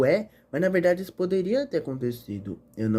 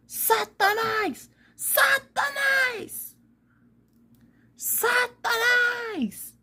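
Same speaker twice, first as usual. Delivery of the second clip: very high, very slow drawn-out calls of Satanás; high stretched-out witch cries of Satanás; old witch calling for dog; much slower than usual. Acted. Witch calls for her lost dog.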